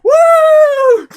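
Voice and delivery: high, loud rodeo woo; cowboy whoop. Pretend. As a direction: whoop like a cowboy on a bucking ride. Riding Triceratops like a cowboy.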